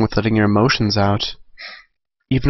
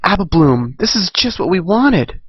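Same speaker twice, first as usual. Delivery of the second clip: excited filly; 8 semitones higher than usual. Acted. Filly calls to her friend.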